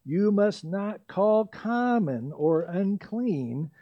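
very slow, measured pronouncement; the Lord slowly correcting Peter; voice of God. Retold or imitated. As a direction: lay each word down slowly and solemnly. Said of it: "a voice came".

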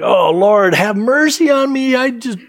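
acted high-pitched plea; broken up. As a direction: plead as a heartbroken parent, rising high. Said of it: "you're so broken up over your child".

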